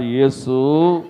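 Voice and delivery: drawn-out plea to Jesus; slow pleading call. Retold or imitated. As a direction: stretch the name out slowly like a beggar calling. Blind man crying out to Jesus.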